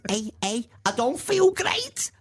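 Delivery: very high, drawn-out mock Scouse; bad Scouse accent. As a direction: squeak out a badly faked Scouse accent. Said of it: "That was supposed to be Scouse".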